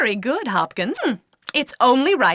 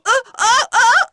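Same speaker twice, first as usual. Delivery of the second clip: startled gasps; 10 semitones higher, 4 dB louder than usual. Acted. Startled teacher reacts.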